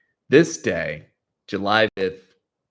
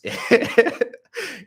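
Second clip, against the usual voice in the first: high burst of real laughter; amused high chuckle; laughing; 12 semitones higher, 4 dB louder than usual. Real, not acted. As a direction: laugh at your own story. Laughs at thousands of viewers.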